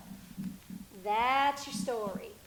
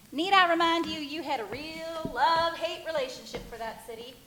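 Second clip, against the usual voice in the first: ordinary delivery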